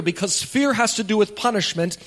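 usual speaking voice

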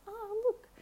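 high light chatter to the parrot; chatting to the bird. Retold or imitated. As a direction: chirp the weather report up high. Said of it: "I say".

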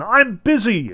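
high, mildly exasperated snap; lightly acted blow-up; acted angry outburst. A narrator's reading. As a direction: blurt it out in irritated protest. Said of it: "Prokhor Petrovich blew up again".

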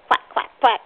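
three punchy play quacks; duck quacking. Shown as a demonstration. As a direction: demonstrate a duck's quack. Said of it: "like a duck".

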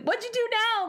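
high mock voice of a weary husband; exasperated husband. Husband asking wife what she did now, high mock exasperation. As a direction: tease him as the fed-up husband. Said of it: "And my husband's like".

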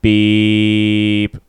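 spoken beep like a censor tone; beep sound. Shown as a demonstration. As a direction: say beep as a bleep effect. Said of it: "you can make a beep".

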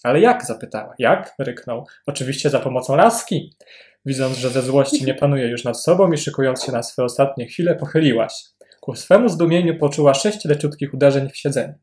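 reading a passage aloud, lively; story reading. A narrator's reading. Podcast host reads a Woolf story excerpt to co-host, lively reading. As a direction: read the excerpt like telling a funny tale.

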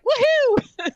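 squealed high, loud woohoo; mock cheer. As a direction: whoop it out like a freed woman. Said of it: "and I was like".

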